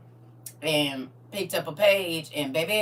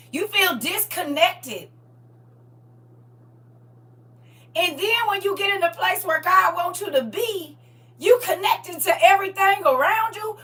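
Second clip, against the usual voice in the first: preaching at full force; 8 semitones higher, 7 dB louder than usual. Acted. Preacher to her online congregation, fervent high preaching. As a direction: lift the room, preach it high and loud.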